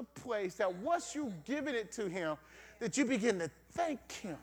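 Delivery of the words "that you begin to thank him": normal talking voice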